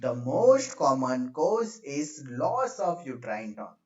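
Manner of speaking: "most common cause" stretched out very slowly; teacher spelling out "loss of uterine tone" unhurriedly for note-takers; slow dictation. Real, not acted. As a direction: dictate it at writing speed, drawing out "most". Dictating while writing it out.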